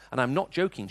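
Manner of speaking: normal talking voice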